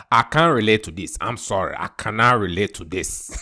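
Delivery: put-on father dismissing his crying son, light West African accent; West African dad. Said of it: "He was like".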